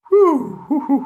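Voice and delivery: high-pitched whooping exhale; whew of relief. Real, not acted. Reacting to a tough kissing scene, high relieved whews. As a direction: let out a string of high whews.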